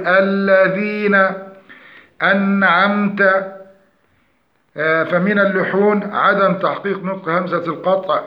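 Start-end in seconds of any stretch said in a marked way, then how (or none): 0.1-3.2 slow, careful tajweed recitation with the hamza fully pronounced; correct Quranic recitation. Shown as a demonstration. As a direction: recite أنعمت with a clear glottal stop on the hamza. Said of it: "فنقول". (تلاوة مجودة بتحقيق الهمزة)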